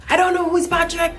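very high, quick mock outcry; squealed, hammed-up protest; exasperated denial. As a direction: re-enact total bafflement, pitch shooting up. Repeats younger self's protest.

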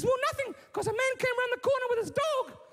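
very high little-daughter voice; squeaky-high mock daughter telling her story; upset daughter. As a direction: put on a high-pitched upset girl. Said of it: "And then she says".

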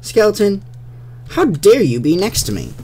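loud, haughty mock outrage; indignant mob voice. Mob scolding a skeleton that stood next to it, mock indignant. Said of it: "He's like".

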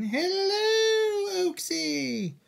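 very high, drawn-out mock queen greeting; hammed-up queen impression. Calling to Oaksy as his illustrious queen, hammed-up high falsetto. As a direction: trill it out like a pantomime British queen. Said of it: "Sound like the queen".

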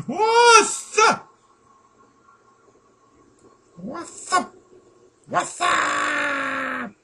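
very high drawn-out catchphrase; saludo chillón exagerado. Pretend. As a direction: squeal it as a silly greeting. Imitates the catchphrase. (saludo bromista en falsete alargado)

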